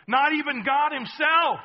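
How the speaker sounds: ordinary delivery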